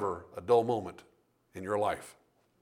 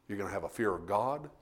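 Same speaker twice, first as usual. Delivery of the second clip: ordinary talk, nothing marked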